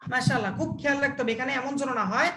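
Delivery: normal talking voice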